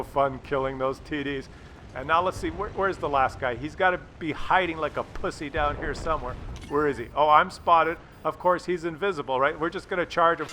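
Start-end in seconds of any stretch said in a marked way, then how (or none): none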